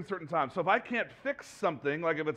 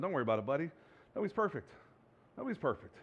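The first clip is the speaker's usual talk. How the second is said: soft, gentle, much quieter comfort; reassuring his son; 3 semitones lower, 7 dB quieter, much faster than usual. Retold or imitated. Father comforting his son after a mistake, gently. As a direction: hush your boy's worry kindly. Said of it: "Like I tell my son".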